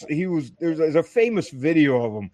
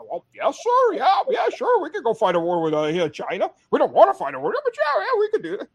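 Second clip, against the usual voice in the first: much higher, squeaky mock of eager yes-men; mocking yes-men chiefs; 10 semitones higher than usual. Service chiefs humoring the senator in a hammed-up high voice. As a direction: ham up a nervous yes-sir voice. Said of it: "the service chiefs are just like".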